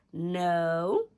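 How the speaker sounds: loud, drawn-out mock no; emphatic denial. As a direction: push back firmly, retelling a quarrel. Retold argument with her friend.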